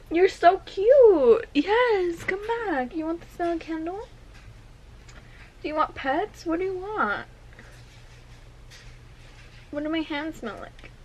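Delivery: high pet-talk voice. Real, not acted. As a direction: coo up high and gently at the cat. Baby talk to the cat.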